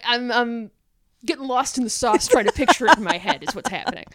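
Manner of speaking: normal talking voice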